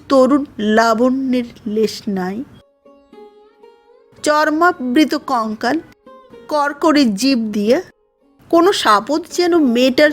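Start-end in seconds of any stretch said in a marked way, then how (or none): none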